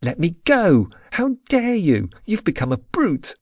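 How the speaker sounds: high, indignant outcry; outraged demand to be released; indignant protest. A narrator's reading. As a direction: protest sharply, pitch jumping up. Heroine protests at being pulled away.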